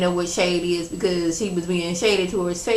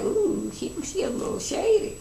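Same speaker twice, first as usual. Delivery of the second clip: soft, high sly "ooh"; a hushed, amused dig; sly tease; 10 semitones higher, 7 dB quieter than usual. Retold or imitated. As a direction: savor the gossip, teasing. Her own sly reaction to Kim.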